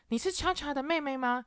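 high-pitched curious fan voice; fan asking. Retold or imitated. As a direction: pipe up like a startled fan. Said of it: "问她说".

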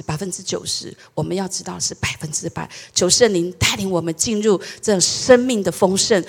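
prayer. Acted. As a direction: pray humbly for the congregation. Leads a prayer.

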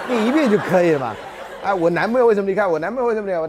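mock her wrong wording while laughing; laughing quote of 我男朋友 with stress on 朋; laughing imitation.

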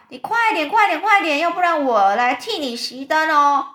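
loud, high shout; impatient yell. A narrator's reading. Centipede hurries the firefly to put out the light, yelling. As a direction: holler to hurry them up. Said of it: "那蜈蚣就大叫".

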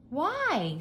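high-pitched maid's voice; high, ready maid; maid's reply. A narrator's reading. Maid answers master.

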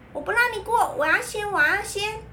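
put-on high, whiny little kid; pushy child voice. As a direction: shove ahead like a bratty kid. Child pushing on stairs voiced.

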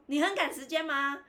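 不耐烦反问. Retold or imitated. 转述牙医不耐烦的反问.

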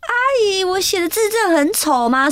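high mock-crying plea; tearful whine. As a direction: whine it pitifully to an auntie. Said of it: "越哭越惨".

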